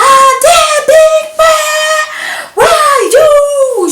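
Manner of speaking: high, loud, drawn-out scream; retold scream. Matron screaming for the student across the hostel, slow high yell. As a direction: stretch the name out in a long slow yell. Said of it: "She was screaming".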